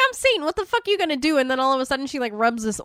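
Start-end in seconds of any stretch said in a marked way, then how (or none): 0.1-1.4 much higher cocky taunt; sneer, hammed-up; lightly voiced smug devil; mock taunt. Satan taunting, retold.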